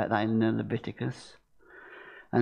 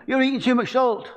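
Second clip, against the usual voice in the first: high, loud scolding doctor voice, leaning on too; doctor's warning; 12 semitones higher, 5 dB louder than usual. Retold or imitated. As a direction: mimic a doctor wagging a finger. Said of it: "Who's been told by the doctor".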